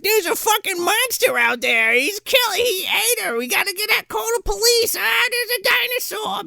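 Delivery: hammed-up high-pitched panic; mock hysterical shriek without much added volume; screaming maniac. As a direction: imitate a frantic man raising the alarm, way up high. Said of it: "screaming like a maniac".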